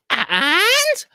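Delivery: high eager parrot; a parrot pressing for news; high squawked and. Acted.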